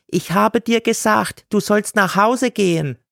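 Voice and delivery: high, scolding woman voice; scolding seller. A narrator's reading. Gailana tells God to go home, high and scolding. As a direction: tell him off sharply.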